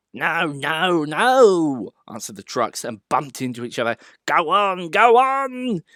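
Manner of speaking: high, loud rowdy truck voices; cheeky trucks. A narrator's reading. Trucks shoving Thomas downhill, rowdy high jeers. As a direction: jeer as you push him on.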